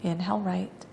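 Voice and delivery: soft, unhurried yoga-teacher cues; guided breathing meditation. Real, not acted. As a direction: guide each breath quietly, with long pauses between cues.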